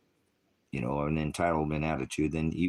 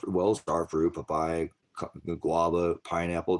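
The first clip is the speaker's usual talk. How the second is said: ordinary talk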